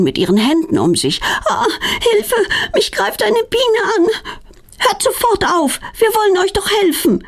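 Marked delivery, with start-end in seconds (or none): 1.5-4.1 frightened cry. Acted. Bee attacks Claudia. 4.8-7.2 much higher, scolding grumble. Acted. Petronella grumbling at the angry bees, scolding. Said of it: "meckert Petronella".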